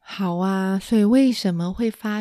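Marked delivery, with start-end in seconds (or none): none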